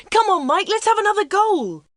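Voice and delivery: high, loud cheering shout; excited fan shouting at the TV. A narrator's reading. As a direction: yell it at the players on the pitch. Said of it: "shouted Peter".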